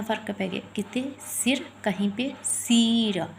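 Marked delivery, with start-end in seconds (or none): 1.3-1.6 सिर with a short, light i; short soft vowel. Shown as a demonstration. Teacher showing pupils the short i sound, short soft vowel. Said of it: "जो softly sound दे". 2.4-3.3 सीर with a long, drawn-out ee; stressed long ee. Shown as a demonstration. Teacher showing how the long vowel differs, stressed long ee. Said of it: "ज़्यादा stress देकर बोलनी पड़ती है".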